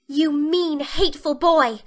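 high, loud indignant outburst; passionate exclamation. A narrator's reading. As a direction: hurl it passionately at the boy who teased you. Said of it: "she exclaimed passionately".

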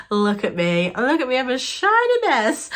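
ordinary delivery

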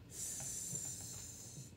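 S as a long voiceless hiss "sss"; letter sound. Shown as a demonstration. Teacher drilling letter sounds, S as "sss". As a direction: hiss S without a vowel.

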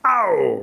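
loud drawn-out ow; pained yelp. Acted. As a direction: yelp as if ears were pulled. Rabbit's ears are pulled.

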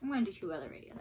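normal talking voice

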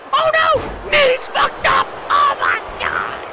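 high, frantic cry of alarm; horrified scream. Real, not acted. Commentator sees the knees go, screaming in horror. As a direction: scream in shock at the damage.

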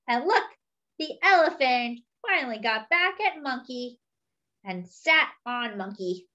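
usual speaking voice

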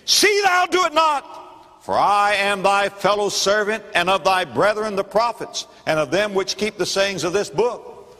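the angel firmly forbidding worship; a lofty heavenly messenger; angel speaking. Acted. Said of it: "Then saith he to me".

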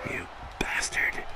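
breathless whispered insult; a hurt whisper at the rival; whispered curse. Acted. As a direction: spit it out under your breath, no voice. Hurt after a hit.

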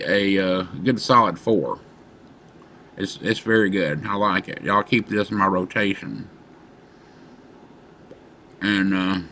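talking around pipe stem; pipe in mouth. Real, not acted. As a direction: lips clenched on pipe. Seen 2: Pipe stem held between lips throughout; smoke exhaled near end.

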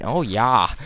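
put-on Wisconsin "oh, yah"; mock Wisconsin agreement; Wisconsin accent. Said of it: "I had a Wisconsin accent".

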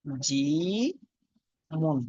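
very slow, each syllable separated; drawn out syllable by syllable. Shown as a demonstration. Teacher spelling out a written word, drawn out slowly. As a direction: stretch the middle syllable with rising pitch. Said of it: "যেমন লিখলাম রজিমুন".

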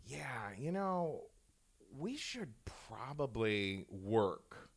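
slow, reflective delivery; measured, drawn-out admission; sobered-up cook. Acted. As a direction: say it haltingly, as if coming to a sober realization. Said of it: "one of the cooks says".

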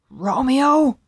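loud, high harsh call; harsh interruption. A narrator's reading. Tybalt interrupts Romeo, harsh loud call. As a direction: cut in sharply with the name. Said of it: "interrupted a harsh voice".

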